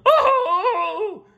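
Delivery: high shrieking yelp; scream. A narrator's reading. Mr. Krabs screaming at the trident, high shriek. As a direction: shriek in terror. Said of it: "screamed Mister Krabs".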